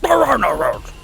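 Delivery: fixed voice declaring no rules; corrected character voice. Acted. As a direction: proclaim it in the proper character voice. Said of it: "Wrong voice".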